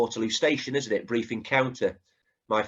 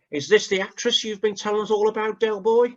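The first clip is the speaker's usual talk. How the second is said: mocking Boycie voice, pitched higher; Boycie impression; 9 semitones higher than usual. Host mimics Boycie teasing Del in pub, higher mocking voice. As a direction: sneer it out as smug Boycie. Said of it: "I'm trying to do Boise impression".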